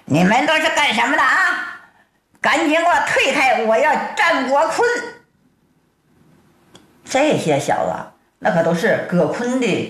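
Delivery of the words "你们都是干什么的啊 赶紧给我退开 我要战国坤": high, fast indignant demand; heated storyteller's character voice; angry. A narrator's reading. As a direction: order them back in a pitched-up temper. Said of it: "唐铁牛气的".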